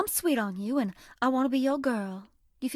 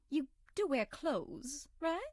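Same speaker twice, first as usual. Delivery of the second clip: sly hushed question; 2 semitones higher, 9 dB quieter, slower than usual. Acted. Teasing doubtful question.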